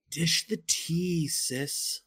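slow drawn-out sassy tease; sassy slang voice. Acted. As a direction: camp it up, slow and sly. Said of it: "stop appropriating things".

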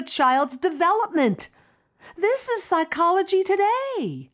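high, eager pleading; pleading daughter. Acted. Daughter urging her mother to read an article, high and eager. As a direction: push the article on mom, eager. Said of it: "management on both sides".

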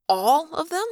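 high, rising disbelief; incredulous, pitch climbing to the end; stunned question. A narrator's reading. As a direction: repeat it as an appalled question. Stunned echo of Ax's words.

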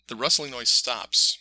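ordinary delivery

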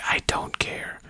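hushed, voiceless whisper; retold whisper. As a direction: whisper it under your breath. Retold muttered aside to friend.

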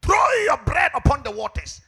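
loud, high-pitched sermon climax; fiery preaching. Acted. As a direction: preach it out to the congregation. Preaching at full force.

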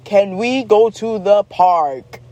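slow, drawn-out stagey request; hammed-up, deliberate plea; theatrical child. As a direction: imitate a kid overacting every word. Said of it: "theatrical way of all times".

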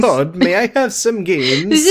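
high, drawn-out pleading peasant; famished peasant. Acted. Host begging Todd for games, famished peasant. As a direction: beg slowly in a small hungry voice. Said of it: "like, a little famished peasant".

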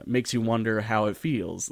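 usual speaking voice

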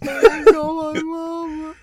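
high, mock whimper for mama; whimpering like a hurt child; just got slapped. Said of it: "your cheek's kinda red".

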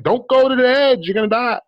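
high-pitched frantic warning, imitated; urgent warning. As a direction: yell at the screen like a worried viewer. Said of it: "trying to tell them".

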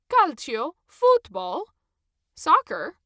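loud, high, enthusiastic questions; cheerfully fishing for a shared topic; Italian ticket checker eagerly tries to chat about football. A narrator's reading.

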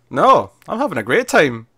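loud, high, swooping mock enthusiasm; hammed-up, defiantly having fun; defiant cheer. June refusing Aunt Lydia's break, defiant mock cheer.

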